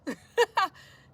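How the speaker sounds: loud, high-pitched laugh; burst of laughter. Real, not acted. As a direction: burst out laughing at your own stumble. Laughs at own word attempt.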